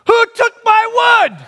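put-on high, loud child demand; imitating son shouting from the garage; indignant child yell. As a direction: call it out like an angry kid.

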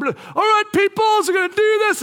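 high, forceful call to a group; bossy rallying call. Retold or imitated. Dad gathering eight kids for pictures, bossy call. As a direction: round them up loudly.

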